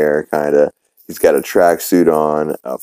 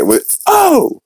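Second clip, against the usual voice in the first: startled yelp. Retold or imitated. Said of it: "and he's like".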